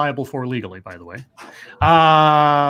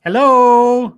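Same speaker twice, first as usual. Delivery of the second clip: loud, higher drawn-out hello; cheery greeting; 8 semitones higher, 8 dB louder than usual. Real, not acted. Host greets a child who said hi, cheery call.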